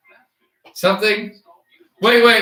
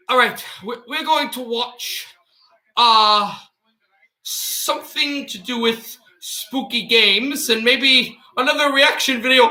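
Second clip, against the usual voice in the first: higher mock British intro, slipping into laughter; Daz Games impersonation; 4 semitones higher than usual. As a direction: keep up the British youtuber voice. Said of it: "I'm doing the Daz Games impersonation".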